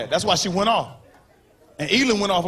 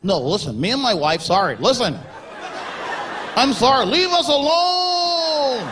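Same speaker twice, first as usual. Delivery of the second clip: mocking Tiger's apology; 3 semitones higher, slower than usual. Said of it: "did you see the apology".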